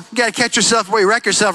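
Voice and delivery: fast throwaway rhyme; quick tossed-off saying; quick catchphrase. Real, not acted. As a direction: rattle the saying off. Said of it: "quick catchphrase".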